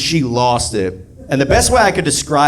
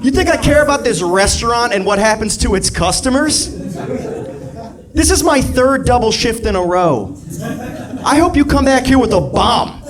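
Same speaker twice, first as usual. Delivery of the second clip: high, worked-up server tirade; comic fed-up outburst; exasperated rant; 8 semitones higher than usual. Acted. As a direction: rant at the customer, pitch raised. Acted rant at customer.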